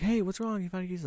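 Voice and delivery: high-pitched voice of a helpful stranger; imitated concerned bystander. Storyteller mimics people in the store, in a high concerned voice. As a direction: lift it high, mimicking someone checking in. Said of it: "and they're like".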